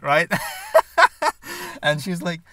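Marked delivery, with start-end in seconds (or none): 0.3-1.8 high, breaking-up laughter; real laugh. Real, not acted. Storyteller amused by Eastern European moment, laughing high. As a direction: burst out laughing at your own joke.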